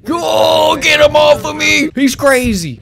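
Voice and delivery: high, loud frantic shouts; real panic, begging to be saved; panicked yelling. Real, not acted. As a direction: scream for help as it climbs on you. Bear attacking him.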